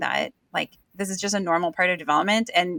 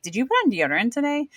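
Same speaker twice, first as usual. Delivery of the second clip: quick, higher teasing question; teasing friend; 5 semitones higher, faster than usual. Retold or imitated. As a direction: needle a buddy playfully. Friend teasing about hygiene.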